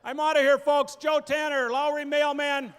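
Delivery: big loud farewell to the crowd; loud sign-off. Real, not acted. Comedian signing off to the audience, loud. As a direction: shout the goodbye over applause.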